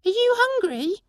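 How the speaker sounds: tiny creature asking in wonder; pixie voice. Acted. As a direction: pixie asking the stranger if rain melts her. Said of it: "asked the little man".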